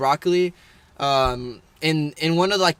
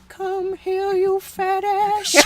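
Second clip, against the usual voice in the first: Herbert the Pervert; 14 semitones higher, much slower than usual. Acted. Old man luring Chris back, high drawn-out creepy voice. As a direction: call out high, stretching the last word. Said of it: "Herbert the Pervert".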